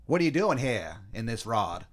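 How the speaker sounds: broad, badly faked Boston vowels; awful Boston accent. Colonist quizzing the stranger, awful Boston accent. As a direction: question him in terrible Bostonese. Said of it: "easily the worst Boston accents".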